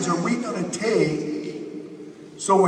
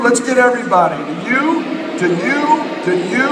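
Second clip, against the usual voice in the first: rallying call; 4 semitones higher, 5 dB louder, faster than usual. Acted. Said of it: "Turn right now and say".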